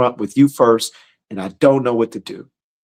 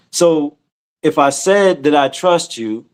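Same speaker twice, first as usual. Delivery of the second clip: normal talking voice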